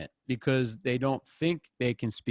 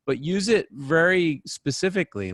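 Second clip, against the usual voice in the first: usual speaking voice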